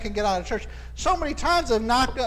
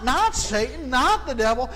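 ordinary delivery

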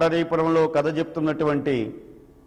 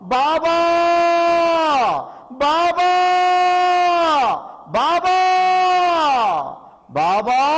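high, louder wails calling out; anguished cries; 11 semitones higher, 4 dB louder, slower than usual. A narrator's reading. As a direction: cry out for him in despair. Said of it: "Dwarakamai నుంచి ఆర్తనాదాలు".

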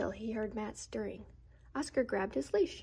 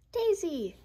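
high, loud call; yelled name; 7 semitones higher, 4 dB louder than usual. A narrator's reading. Girl yells for her dog, high and loud. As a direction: shout the dog's name. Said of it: "yelled the girl".

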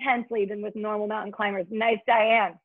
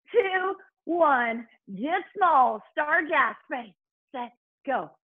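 ordinary delivery

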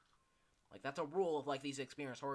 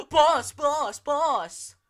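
frantic high shouting for the boss; panicked worker calling; 12 semitones higher, 17 dB louder, much slower than usual. Pretend. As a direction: gasp then yell for the boss. Voicing panicked game character.

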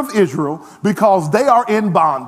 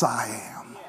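whispered yes; 11 semitones lower, 11 dB quieter than usual. Real, not acted. Quiet affirmation after "Yes.

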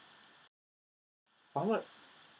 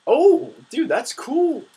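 excited; 14 semitones higher, 8 dB louder, much slower than usual. Real, not acted. Unlocking new kart parts.

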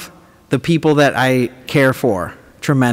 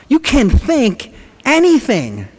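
awed tone; 10 semitones higher, slower than usual. Acted. Comedian acting wonder about thinking.

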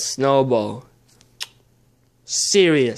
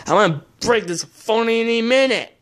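frustrated; 7 semitones higher than usual. Real, not acted. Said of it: "So I'm swearing because it's difficult".

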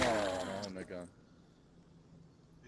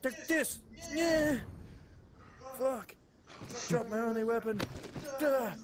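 excited yelling; 10 semitones higher, slower than usual. Real, not acted. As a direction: yell at the zombie. Fighting zombie, yelling.

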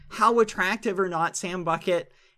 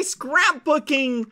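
ordinary delivery

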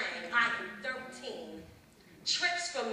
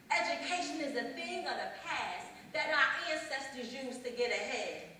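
normal talking voice

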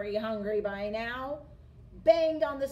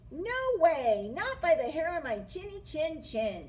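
high, loud little pig cry; second pig crying out at the wolf; 6 semitones higher, 4 dB louder than usual. A narrator's reading. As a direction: shout him off, high and scared. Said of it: "cried that second little pig".

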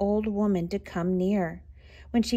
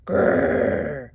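low rolled bear growl; bear's growl; 8 semitones lower, 4 dB louder than usual. A narrator's reading. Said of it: "the bear jumped up".

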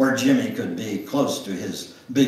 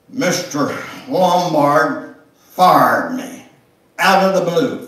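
slow, louder and higher with shock; stunned announcement; 5 semitones higher, 9 dB louder, slower than usual. Acted. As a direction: spell out the bad news slowly. Father announces being fired.

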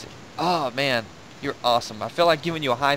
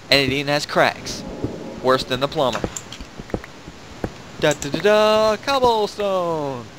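loud hammed-up ad read, pitch raised; mock infomercial; 2 semitones higher, 4 dB louder than usual. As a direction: sell cobblestone like a cheesy TV pitchman. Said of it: "And now, a word from our sponsors".

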